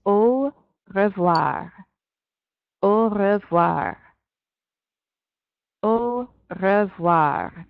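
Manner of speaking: Au revoir drawn out slowly, rolled French r; teacher modelling Au revoir slowly, rolled French r; slow French demo. Shown as a demonstration. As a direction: spell out Au revoir slowly for beginners. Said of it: "what you would say is".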